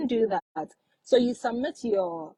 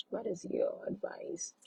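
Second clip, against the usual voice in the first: whisper; 11 dB quieter than usual. Real, not acted. Reading a viewer's question aloud.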